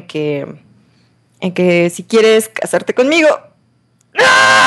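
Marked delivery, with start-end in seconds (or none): 4.2-4.6 sudden high yelp of surprise; shriek, caught off guard; short excited scream; high surprised shriek. Real, not acted. Sudden shriek at achievement popup.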